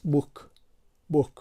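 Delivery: بوک twice, clipped short oo; English word book. Shown as a demonstration. Teacher modelling English book with short oo. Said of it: "کلمهٔ اول چی است؟".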